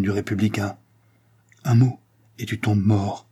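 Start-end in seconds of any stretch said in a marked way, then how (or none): 1.6-3.3 grave threat. A narrator's reading. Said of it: "d'une voix grave".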